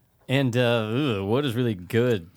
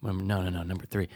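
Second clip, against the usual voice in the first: softer, whispered exclamation; whispering; 4 semitones lower, 7 dB quieter than usual. Real, not acted. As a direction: hush it like a confession. Hushed aside between hosts.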